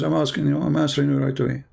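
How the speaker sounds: ordinary delivery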